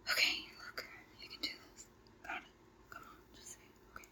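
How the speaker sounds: soft, private self-encouragement under the breath; whispered pep talk. Acted. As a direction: whisper to herself to steady her nerves.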